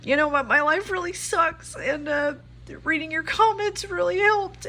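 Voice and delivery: high, strained voice on the edge of tears; fighting back tears while thanking viewers; voice breaking. Real, not acted. Vlogger telling viewers goodbye, voice cracking with tears. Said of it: "I'm trying not to get emotional right now".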